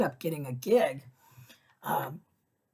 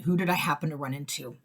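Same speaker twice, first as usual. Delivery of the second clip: normal talking voice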